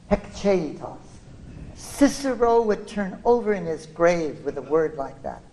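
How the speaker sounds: ordinary talk, nothing marked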